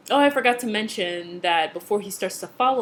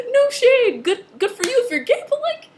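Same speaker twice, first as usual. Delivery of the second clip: shocked laughter; 5 dB louder than usual. Real, not acted. Shocked by surprise kiss twist.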